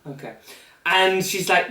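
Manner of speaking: ordinary delivery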